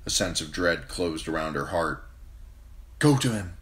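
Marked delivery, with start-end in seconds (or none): 3.0-3.6 a higher, louder order to her knight; a queen's sharp command. A narrator's reading. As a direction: give the order, urgent and firm. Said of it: "she commanded Ser Jorah".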